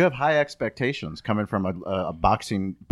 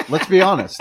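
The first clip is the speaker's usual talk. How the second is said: loud, laughing, pitched up high; laughing emphasis; 8 semitones higher, 10 dB louder than usual. Real, not acted.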